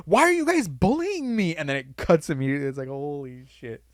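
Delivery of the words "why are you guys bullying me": high put-on whiny voice; imitating the girl. Podcaster repeating the sketch punchline to his cohost, high and whiny. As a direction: sound hurt and innocent. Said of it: "the punchline".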